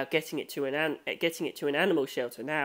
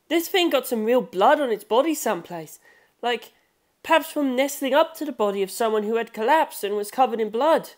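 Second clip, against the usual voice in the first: higher, louder, sharp know-it-all insistence; Rohan Kishibe; 6 semitones higher, 3 dB louder than usual. Acted. Rohan correcting the narrator about real blood, insistent. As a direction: cut the other down with certainty.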